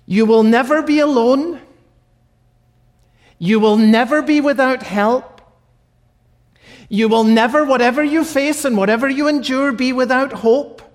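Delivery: preacher building to a passionate climax; building preaching. Real, not acted. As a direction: proclaim it to the congregation with rising force.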